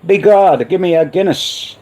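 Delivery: bad high-pitched Irish accent. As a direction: ham up a stage Irish accent. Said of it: "I've got a terrible Irish accent".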